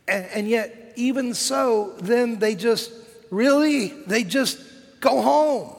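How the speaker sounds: high-pitched, building disbelief; incredulous. Acted. Preacher on the disciples just going home, incredulous. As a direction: climb to a loud, baffled finish.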